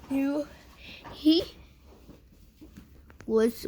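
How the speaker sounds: usual speaking voice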